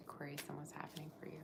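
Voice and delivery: quick, soft whisper; hushed whisper. Real, not acted. Asking Aquarius viewers about their energy, quick whisper. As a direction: whisper it fast and secretive. Tarot reader asks the cards.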